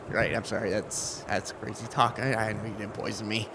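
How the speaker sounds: soft, low, embarrassed backing down; sheepish. Acted. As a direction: mumble a sheepish apology. Man backs down, embarrassed.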